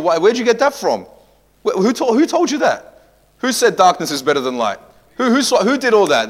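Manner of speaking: high, loud disbelief; incredulous exclamation. Real, not acted. Preacher asks the church in astonished disbelief. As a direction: demand it in disbelief.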